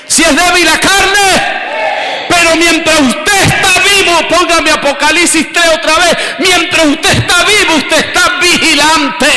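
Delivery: high shouted prayer, slowing on key words; preaching at full force. Acted. Pastor leading the church in a shouted prayer. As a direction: lift the crowd into praise.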